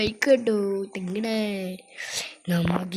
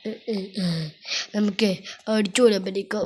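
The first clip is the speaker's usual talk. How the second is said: ordinary delivery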